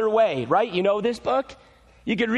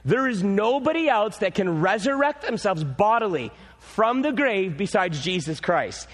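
usual speaking voice

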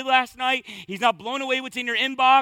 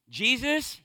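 normal talking voice

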